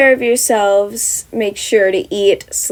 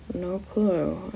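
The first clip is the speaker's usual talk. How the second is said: mutter; 11 dB quieter than usual. Acted. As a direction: mumble it under your breath. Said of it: "Aizawa mutters".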